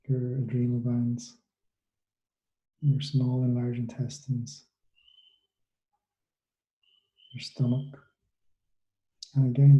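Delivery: soothing meditation guide, unhurried and hushed; guided meditation. Acted. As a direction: ease them gently through the body scan. Leads a guided body-scan meditation.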